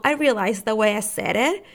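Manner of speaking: ordinary delivery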